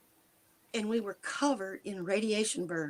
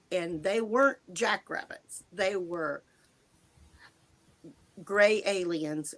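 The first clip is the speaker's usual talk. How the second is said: ordinary talk, nothing marked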